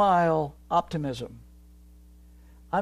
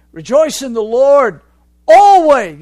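proclaiming loudly; 7 semitones higher, 18 dB louder than usual. Acted. Preacher quoting Paul loudly.

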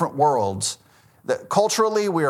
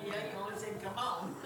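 much softer, breathy through laughter; father laughing, answering his daughter softly; 6 semitones higher, 17 dB quieter than usual. Real, not acted. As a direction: giggle the words out. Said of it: "laughing reply to daughter".

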